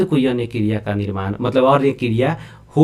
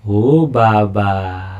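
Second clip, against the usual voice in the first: very slow, stretched-out words; long, lingering "oh dear" delivery; drawn-out exclamation; 5 semitones lower, much slower than usual. Real, not acted. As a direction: draw out each word on spotting the catch. Mock dismay at catching the answer.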